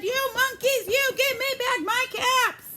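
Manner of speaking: full angry shout; furious shout. Acted. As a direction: stamp and shout at the monkeys. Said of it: "He stamped his feet and shouted".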